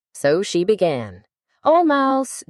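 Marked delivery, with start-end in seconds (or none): 1.6-2.4 high, earnest call to the mouse; a child politely calling out to a mouse; formal address. Acted. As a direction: address the mouse grandly, pitched up. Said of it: "the right way of speaking to a mouse".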